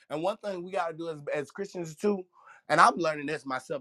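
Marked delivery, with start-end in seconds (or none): none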